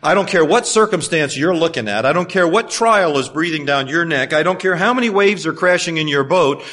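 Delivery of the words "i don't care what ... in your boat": usual speaking voice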